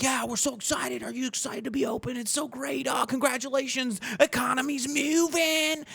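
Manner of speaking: high, gushing fake excitement; mock excited people. People cheering a friend's reopening, mock high gush. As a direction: gush like eager customers. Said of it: "all these people were like".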